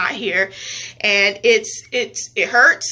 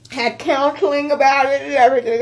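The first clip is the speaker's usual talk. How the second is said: real crying; 3 semitones higher, 3 dB louder than usual. Real, not acted. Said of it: "I'm, like, boo-hoo crying".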